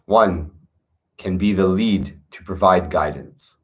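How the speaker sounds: steady meditative recitation; scripture reading. Acted. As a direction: read each line like a sacred verse, unhurried. Continues reading the chapter.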